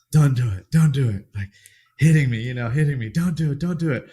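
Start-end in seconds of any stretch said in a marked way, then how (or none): 0.1-1.3 mock-urgent wife, louder and higher; urgent pleading. Wife urgently stopping him, acted. 3.1-4.1 mock-urgent wife, louder and higher; urgent pleading. Wife urgently stopping him, acted.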